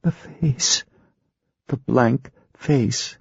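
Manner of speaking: high, hushed, haunted. Acted. Ginger in trance, high and haunted. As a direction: speak as if seeing a horror. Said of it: "in a haunted voice".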